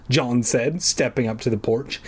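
ordinary delivery

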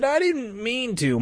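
high, slowed regretful plea; pleading apology. A narrator's reading. Jay apologising to bitten Mike, pleading.

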